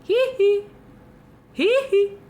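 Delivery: squeaky little hee hee; high giggle. Acted.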